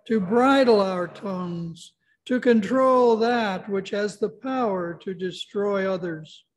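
normal talking voice

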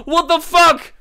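very high, very loud yell; real shocked outburst; startled shout. Real, not acted. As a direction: yell it out in disbelief. Startled at hearing a chicken.

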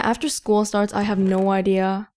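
usual speaking voice

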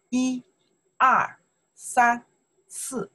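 一, 二, 三, 四 counted out one by one, high; counting demonstration. Shown as a demonstration. Teacher showing students how to count, 一, 二, 三, 四 demonstrated. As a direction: count 一, 二, 三, 四 as separate model words. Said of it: "we count number. We say".

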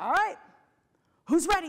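rally the ballroom, loud and bright; calling out to the room. Retold or imitated. Motivational speaker rousing five hundred people, called out loud.

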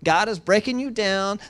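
usual speaking voice

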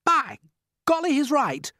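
high, loud centipede exclamation; startled cry. A narrator's reading. Centipede suddenly realises earthworm has a point, crying out. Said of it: "cried the centipede".

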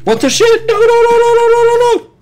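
shrieking high and loud in panic; terrified screaming. Real, not acted. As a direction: scream no over and over. Monster catches him in game.

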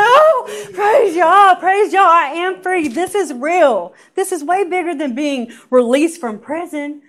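high, loud crying shout of praise; screaming in joy. Retold or imitated. Alone in the bathroom, shouting her freedom to God, crying and loud. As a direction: cry out to heaven, overjoyed and sobbing. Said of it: "I just started screaming in the bathroom".

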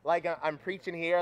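ordinary talk

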